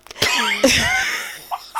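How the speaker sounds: high squealing laugh breaking out; bursting laugh. Real, not acted. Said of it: "I was trying not to laugh".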